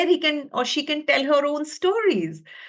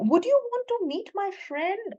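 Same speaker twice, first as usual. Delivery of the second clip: excited invitation; 9 semitones higher than usual. Acted. Teacher introducing puppet to audience.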